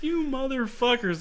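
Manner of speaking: high, amused outburst through laughter; real delighted disbelief; laughing curse. Real, not acted. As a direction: curse them while cracking up. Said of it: "I was like".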